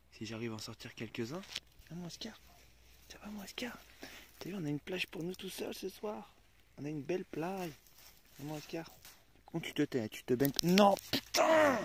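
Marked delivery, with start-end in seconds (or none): 1.9-5.3 soft whisper to his dog; chuchoté. Real, not acted. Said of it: "Je parle pas très fort". 10.6-11.8 drawn-out, exasperated swear at the dog; exasperated curse. Real, not acted. Dog jumps in the water.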